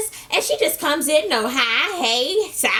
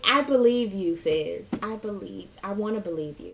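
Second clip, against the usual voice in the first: softer and softer, barely believing; fading unconvinced; 4 semitones lower, 6 dB quieter than usual. Retold or imitated. Doubtful recapper answering Fizz, fading to near silence. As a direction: trail off, clearly doubting.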